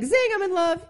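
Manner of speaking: high, loud lovestruck squeal; giddy gushing. Retold or imitated. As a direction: squeal it like you're smitten. Retelling a friend's gushing line.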